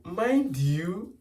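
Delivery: ordinary delivery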